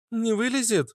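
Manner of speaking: high-pitched, apprehensive question; high voice with a touch of fear; wary. A narrator's reading. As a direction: ask warily in a high character voice. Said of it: "с опаской спросила Фитилёк".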